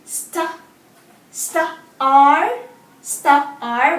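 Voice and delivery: Sta and R split apart; sounding out words. Shown as a demonstration. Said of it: "What's the word?".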